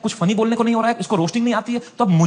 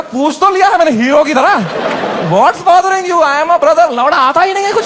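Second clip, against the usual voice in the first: louder and higher, laughing at himself; exasperated comic rant; 6 semitones higher, 6 dB louder than usual. Real, not acted. Comedian mocking his own heroic consoling line, loud and exasperated. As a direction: blurt it out in comic panic.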